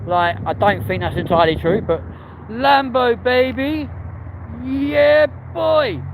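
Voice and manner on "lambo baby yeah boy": high-pitched hype on spotting a supercar; excited cheer. Real, not acted. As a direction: whoop it out, high and drawn. Spots a Lamborghini, cheers.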